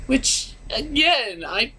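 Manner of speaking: barely holding it together over the cuteness; overwhelmed. Real, not acted. Reviewer overwhelmed by a sweet scene, high and strained. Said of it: "I'm not sure if I can do it".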